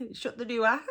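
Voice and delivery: DOOR in two syllables, "doo-ah", rising. Shown as a demonstration. Teacher quotes what people say, DOOR broken into "doo-ah". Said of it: "put extra syllables in words".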